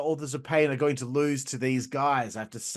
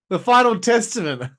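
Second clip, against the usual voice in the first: booming wrestling announcer name call; announcer callout; 6 semitones higher, 8 dB louder than usual. Pretend. Vlogger hyping the team like a ring announcer, booming.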